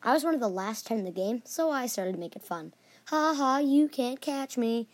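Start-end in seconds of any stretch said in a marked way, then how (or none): 3.1-4.9 higher, drawn-out singsong taunt. Acted. Last player dancing before opponents in dodgeball, taunting. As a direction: tease mockingly, stretching the words. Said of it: "I taunted".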